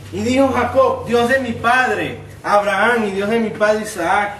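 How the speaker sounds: high, loud, fervent reading of the verse; preached scripture reading. Acted. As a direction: pulpit reading with full preaching force.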